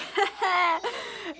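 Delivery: high-pitched imitated laugh; mock nervous laugh. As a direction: put on a high laugh, trying to seem cool. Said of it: "and I'm just like".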